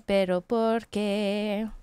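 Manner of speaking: low, slow lament; sadness. Pretend. Scene: streamer lamenting a broken game, slow sad question. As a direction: ask it slowly, sinking into dejection. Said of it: "The sadness is real".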